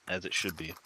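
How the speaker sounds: usual speaking voice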